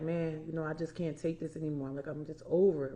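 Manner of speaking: soft, low and worn out, barely above a breath; weary quiet despair. Retold or imitated. Telling listeners about bedtime crying, quietly defeated. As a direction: let it sink like you're done with everything. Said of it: "And I'm like".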